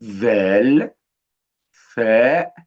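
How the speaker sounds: "ذال، ثاء" with interdental TH sounds, voiced then unvoiced; tongue between teeth. Shown as a demonstration. Teacher models letters for children, tongue-between-teeth TH sounds. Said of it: "ونحط طرف لساننا تحت أسناننا".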